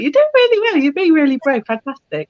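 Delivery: encouraging praise. Retold or imitated. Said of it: "feeding them treats just to be like".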